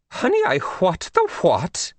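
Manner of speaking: lofty high royal tone; queenly voice. A narrator's reading. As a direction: scold gently with royal poise. Queen of England on phone.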